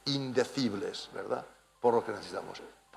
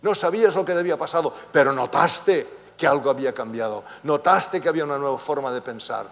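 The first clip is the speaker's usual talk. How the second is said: raised, higher preaching voice; impassioned preaching; 4 semitones higher, 7 dB louder than usual. Real, not acted. Preacher urging the brethren on being born again, impassioned. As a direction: press each point home with mounting force.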